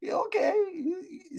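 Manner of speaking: ordinary talk, nothing marked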